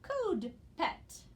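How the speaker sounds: "code pet" with a long o, falling at the end; careful word reading. Shown as a demonstration. Teacher says what the word is, "code pet" carefully sounded out. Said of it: "and the word is".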